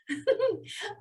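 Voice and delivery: short high giggle. Real, not acted. Teacher amused by silly smelling verse, giggling. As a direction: let out a quick high laugh.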